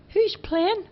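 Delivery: put-on high boyish voice, pitched well up; Charlie's voice. A narrator's reading. Charlie agreeing with Bobo, high boyish voice. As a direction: play young Charlie, light and high. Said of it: "Charlie asked".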